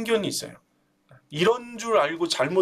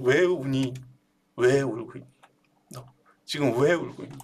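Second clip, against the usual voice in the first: tender asking; 7 semitones lower than usual. Acted. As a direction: gently ask the weeping one why. God gently asks why weeping. (우는 이에게 낮고 부드럽게 묻는 목소리)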